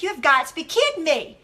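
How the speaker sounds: indignant re-enactment, punching key words; fired up. Retold or imitated. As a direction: act out being stung and ready to tell someone off. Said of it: "I was fired up".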